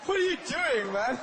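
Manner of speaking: high, baffled what are you doing; confused victim. Retold or imitated. Victim questioning the Indian robber, high confused disbelief. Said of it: "You'd be like".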